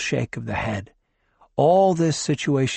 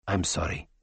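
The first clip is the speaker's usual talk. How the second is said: low, soft and regretful; doctor apologizing again to the dying man; quiet apology; 7 semitones lower, 7 dB quieter than usual. Acted. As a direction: say it gently, lower and softer. Tachyon repeats apology quietly.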